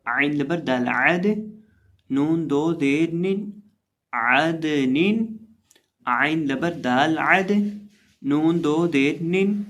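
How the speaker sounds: teacher drilling letter by letter; slow spelling drill. Shown as a demonstration. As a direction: spell it out slowly and loudly for students. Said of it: "اب یہاں یہ جو ہمارے پاس پہلا لفظ ہے".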